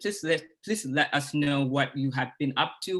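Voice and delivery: usual speaking voice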